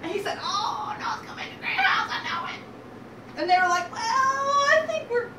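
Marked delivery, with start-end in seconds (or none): 0.4-2.6 very high, fast piglet squeak; insistent little pig. Acted. First pig insists to brothers. 3.9-5.3 high, drawn-out, doubtful "well"; reluctant hedging. Acted. Brothers hesitantly decline.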